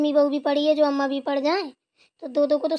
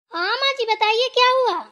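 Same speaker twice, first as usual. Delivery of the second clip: higher, called reply to mother-in-law; a daughter-in-law calling back, higher and raised; 7 semitones higher, 3 dB louder than usual. Acted. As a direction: answer her call from the next room. Answering mother-in-law's call from afar.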